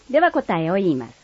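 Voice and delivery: normal talking voice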